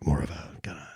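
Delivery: low whispered rasp; raspy whisper. Retold or imitated. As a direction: hush it down into a rasp. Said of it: "a raspy voice like this".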